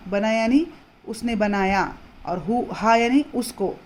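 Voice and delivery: ordinary talk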